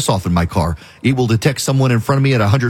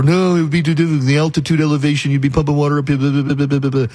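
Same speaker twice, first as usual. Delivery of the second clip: whiny skeptic, lightly put-on, trailing into blah blah; mocking doubters; 3 semitones higher than usual. Host parodying critics of the water pipeline, dismissive mock whine. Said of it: "And people are".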